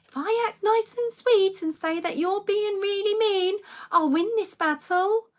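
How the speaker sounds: high, slow, mock-sweet voice; sweetly patronising. Parent mimicking the health worker's nice act, sweetly patronising. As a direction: imitate fake niceness hiding a wish to win. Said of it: "in a really nice tone, which is really patronising".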